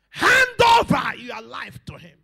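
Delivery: slow, high, very loud opening, then easing down; exhorting shout. Real, not acted. Preacher urging believers to surrender, shouting. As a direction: stretch the command out with full force.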